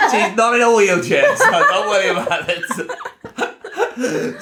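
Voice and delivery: high, loud laughing words. Real, not acted. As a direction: laugh through it, slowing down. Joking, laughing hard.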